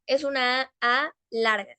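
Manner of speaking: usual speaking voice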